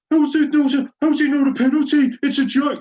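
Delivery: high, loud mock outrage; incredulous pundit. Mocking Alan Smith's outrage on commentary, high shouted disbelief. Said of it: "Alan Smith is incredulous".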